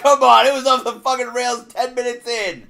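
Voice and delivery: high, very loud mock outrage; indignant shouting. Co-host accused of derailing the show, shouting indignantly. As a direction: yell back in exaggerated protest.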